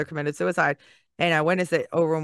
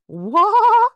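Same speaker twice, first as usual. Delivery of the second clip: high, loud shocked squeal; a sudden disbelieving shriek; shocked what; 8 dB louder than usual. Real, not acted. Amazed at famous speakers talking to them, a high squeal.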